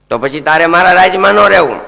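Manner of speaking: loud, fast royal order; stern king. Acted. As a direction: banish him sharply. King banishes Kali.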